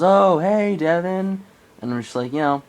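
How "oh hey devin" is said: high, loud call across a lake; loud friendly hail; retold yell. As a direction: yell out a greeting from far away. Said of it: "his dad just yells".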